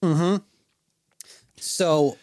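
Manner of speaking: normal talking voice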